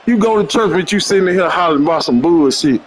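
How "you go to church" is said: ordinary talk, nothing marked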